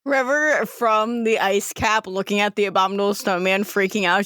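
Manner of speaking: usual speaking voice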